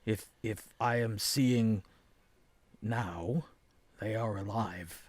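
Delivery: low, hushed character voice; subdued, absorbed seer. Acted. Game master voices Viraz explaining his vision.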